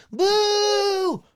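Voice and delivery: extremely high falsetto boo; different-voiced boo. Shown as a demonstration. Asked for a different crowd take, falsetto jeering. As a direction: squeal the jeer as another crowd member. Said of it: "you to do a different".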